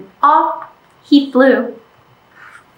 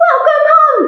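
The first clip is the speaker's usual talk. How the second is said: happy greeting; 13 semitones higher than usual. Acted. Bear greeting duck warmly.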